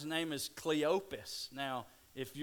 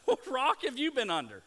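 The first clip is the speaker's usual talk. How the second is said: fast, loud, high disbelief; incredulous retort; 11 semitones higher, 10 dB louder, faster than usual. Retold or imitated. Cleopas snapping back at the stranger, fast loud disbelief. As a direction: fire it back like he can't believe it. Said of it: "this is the response that he gives".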